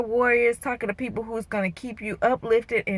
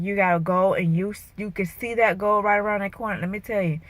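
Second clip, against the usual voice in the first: normal talking voice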